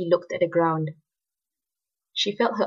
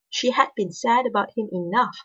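ordinary delivery

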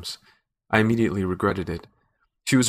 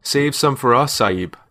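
louder, higher jeer; grinning shout; 4 semitones higher, 6 dB louder, slower than usual. A narrator's reading. Village man jeers at the man holding her, shouting. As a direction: shout it with a grin. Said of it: "One of the men grinned and shouted".

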